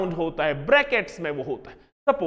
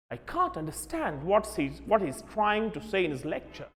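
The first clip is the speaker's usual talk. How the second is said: put-on British accent, can't said with a broad ah, softer; softly mocked British accent; 8 dB quieter than usual. Teacher imitating a British student, British accent. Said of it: "But Britishers".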